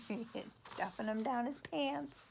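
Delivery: ordinary delivery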